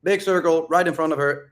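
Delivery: ordinary talk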